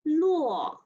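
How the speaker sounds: "luo." falling from high, fourth tone. Shown as a demonstration. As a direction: snap "luo." downward. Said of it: "Line number fifteen".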